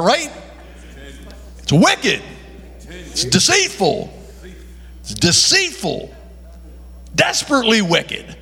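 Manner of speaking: normal talking voice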